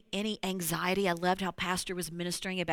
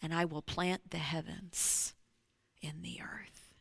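hushed whisper; 3 semitones lower, 8 dB quieter than usual. Acted. Quoting Isaiah's promise.

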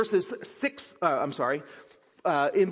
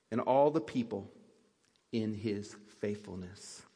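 solemn scripture reading; 5 semitones lower than usual. Acted. Pastor reading Psalm ninety-six to the congregation, reverent. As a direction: read the psalm aloud like a prayer to the church.

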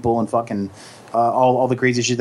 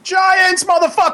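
higher, triumphant fan cheer; triumphant shout; 3 dB louder than usual. Real, not acted. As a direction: cheer for your team. Fan cheering his team.

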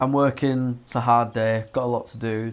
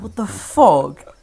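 stunned; 7 semitones higher, 9 dB louder, much slower than usual. Retold or imitated. Finding his car advertised.

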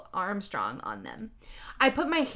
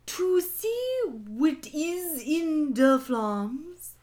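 very slow, high, hammed-up wizard voice; slow, theatrical wizard pronouncement; Dumbledore; 6 semitones higher, much slower than usual. A narrator's reading. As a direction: draw every word out like a mystic old headmaster. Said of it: "My Dumbledore voice again".

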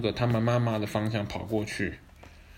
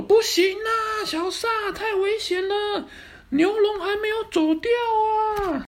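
alarmed high cry; 4 dB louder than usual. Acted. Hero calls child back.

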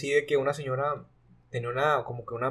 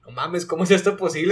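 raised, louder burst of disbelief; amazed exclamation; 5 semitones higher, 8 dB louder than usual. Retold or imitated. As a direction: blurt it out like you can't believe your eyes. Retelling his amazed reaction.

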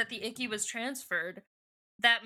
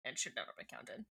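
dry low aside; 5 semitones lower, 7 dB quieter than usual. Pretend. Meme repeated as dry aside.

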